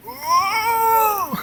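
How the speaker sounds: squealed high ooh of wonder; high soft awed ooh. Acted. Acted scene, touching spear.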